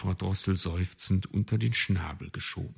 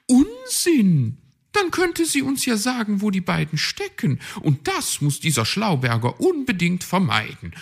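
much higher and louder, scornful; indignant high magpie; 13 semitones higher, 7 dB louder than usual. A narrator's reading. Magpie scoffs at the raven's doubt, indignant. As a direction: dismiss it sharply.

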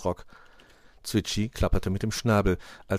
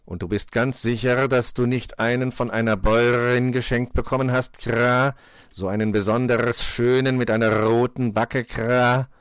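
loud cawing raven, rolled r's; cross-examine, ending on a crow's "Kra"; insistent croaking interrogation; probing raven; 4 semitones higher, 6 dB louder than usual. A narrator's reading. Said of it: "noch einmal ganz genau versichern".

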